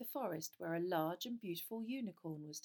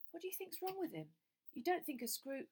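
put-on worried boy; 9 semitones higher than usual. A narrator's reading. Harry worried to Ron.

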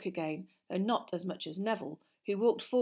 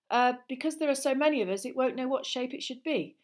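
higher, louder pupil voice; tentative schoolboy answer; 6 semitones higher, 6 dB louder than usual. Acted. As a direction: work out the answer aloud, pitched up.

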